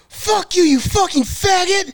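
high furious yell; angry shout. Retold or imitated. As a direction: spit it out at him. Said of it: "his first response".

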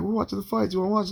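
high, casual mock invite voice; neighbors inviting. Neighbors inviting him to watch the fights, a high casual invite. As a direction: mimic the friendly neighbors offering a seat.

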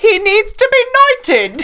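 high-pitched echo of a mock queen. Co-host echoing the queen impression, high mock-regal voice. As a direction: parrot the queen impression teasingly. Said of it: "you know, the queen says".

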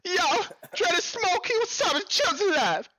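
very high, squealed hype greeting; hammed-up hype. Host greets two viewers by name, over-the-top hype.

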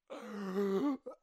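sudden rasping intake of air; imitated gasp for breath. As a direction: re-enact waking up gasping. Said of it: "I'm out of breath".

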